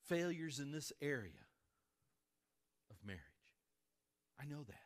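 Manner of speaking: sincere confession. Real, not acted. Pastor confiding his burden.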